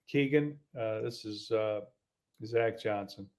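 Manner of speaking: low, halting phone introduction; bad-news tone. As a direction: hesitate through it like you dread the call. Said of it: "I could tell by the tone of his voice".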